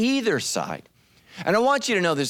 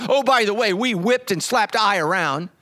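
mock boast; 4 semitones higher than usual. Mock Facebook post by Israelites.

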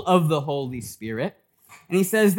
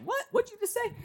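high-pitched, softer mock indignation; mock outraged disbelief; 7 dB quieter, faster than usual. Church council reacts to Stephen's accusation, mock outraged. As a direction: imitate offended listeners, pitch shooting right up. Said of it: "they got mad".

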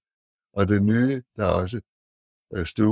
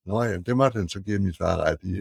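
ordinary talk

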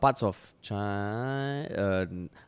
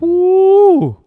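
whoop; 15 dB louder than usual. Real, not acted. Cheering mention of a name.

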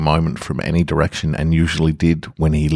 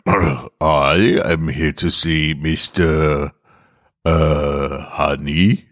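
slow, halting gruff disguise; slow gruff fumbling; much slower than usual. Acted. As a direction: drag it out like a man faking composure. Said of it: "He said in a gruff voice".

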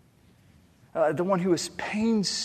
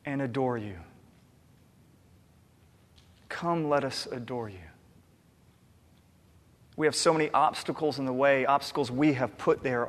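quiet prayer; 2 semitones lower, 6 dB quieter than usual. Real, not acted. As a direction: pray it quietly and humbly. Pastor leads a quiet prayer.

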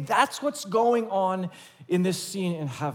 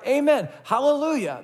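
amen, hallelujah cried high and loud; 7 semitones higher, 4 dB louder than usual. Retold or imitated. Elders crying praise before the throne, high loud cry. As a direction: raise it like a crowd's cry. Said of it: "they cried".

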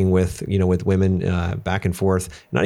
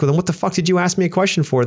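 exasperated; 9 semitones higher, faster than usual. Retold or imitated. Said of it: "I'm like".

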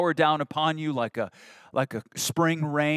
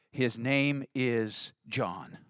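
slow, solemn; 5 semitones lower, 8 dB quieter, much slower than usual. Acted. Zechariah's written answer.

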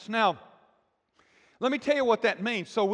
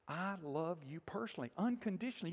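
much softer, almost whispered, gentle; God's love spoken softly to each listener; hushed tender vow; 3 semitones lower, 12 dB quieter than usual. Acted. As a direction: murmur it like a tender promise. Quoting God's tender promise.

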